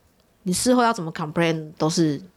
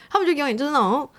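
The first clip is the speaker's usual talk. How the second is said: normal talking voice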